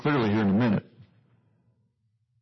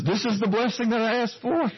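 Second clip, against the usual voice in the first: sarcastic Esau; 10 semitones higher than usual. Acted. Esau reacts to curse-like blessing.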